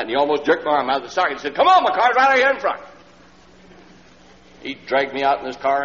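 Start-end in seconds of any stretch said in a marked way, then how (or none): 1.6-2.8 high, loud and urgent; impatient excited call to come now; eager hurry. Retold or imitated. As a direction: pull him along to the car. Man drags preacher to car.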